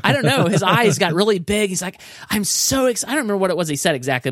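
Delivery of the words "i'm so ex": excited presenter. Retold or imitated. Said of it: "it was just like this".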